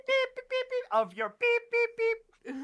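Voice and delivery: high, loud censor beep imitation; piercing vocal beeps, evenly repeated; imitated censor beeps. Bleeping out a friend's swearing, high imitated beeps. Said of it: "censorship beeps and bloops".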